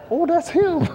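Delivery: high, laughing Oh, that's him; laughing recognition. Retold or imitated. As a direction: spot him and crack up, pitched high. Spotting the boy, laughing.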